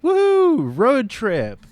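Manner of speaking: very high woohoo; mock cheer. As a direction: cheer it sarcastically. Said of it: "And so it's like".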